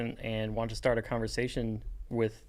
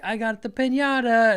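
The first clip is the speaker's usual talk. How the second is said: high, louder piñata-bringer's announcement; mock excited; 9 semitones higher, 6 dB louder than usual. Imagined party guest showing off the piñata, mock excited. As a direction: proudly announce it in imitation.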